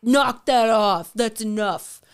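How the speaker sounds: a hint of dad laying down the law; lightly voiced firm, slow telling-off; imitated stern dad. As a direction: tell them off like the group's regulator. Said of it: "the dad of the group".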